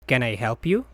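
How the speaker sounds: ordinary delivery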